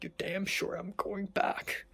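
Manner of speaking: slow, emphatic whisper; whispered vow. Real, not acted. Emphatic vow, spoken under the breath.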